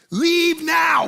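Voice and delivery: loud, high-pitched panicked order; acting out a frantic yell to clear out; panicked shout. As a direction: shout it in urgent alarm. Retold panicked order to kids.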